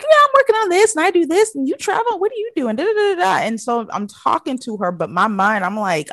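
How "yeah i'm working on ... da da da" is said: high, quick mock small talk; high-pitched chatterbox imitation; imitated chatty daughter.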